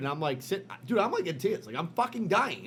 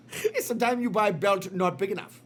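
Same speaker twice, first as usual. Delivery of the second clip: put-on Indian accent; 5 semitones higher, 5 dB louder than usual. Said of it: "Your impression of Indian guys".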